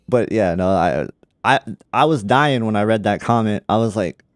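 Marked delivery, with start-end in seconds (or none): none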